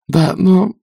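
high, flustered objection; nervous, high-pitched young witch; timid babble. A narrator's reading. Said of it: "пролепетала она".

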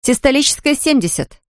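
high-pitched urgent report from a nurse; calling out. A narrator's reading. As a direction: call the reading across the operating room. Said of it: "крикнула медсестра".

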